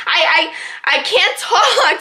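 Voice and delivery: high, halting stammer; reenacted breathless-sounding stammer; struggling to talk. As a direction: act out fighting to get words out. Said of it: "getting frustrated that I can't talk".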